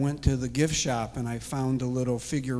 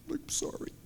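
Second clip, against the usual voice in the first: a small whispered sorry; whispered apology; 11 dB quieter than usual. Retold or imitated. Man answering God's rebuke, whispered apology. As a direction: whisper it, ashamed.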